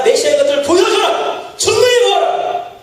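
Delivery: mock devil shouting a challenge; devil demanding. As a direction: dare him to prove it. Satan demands proof.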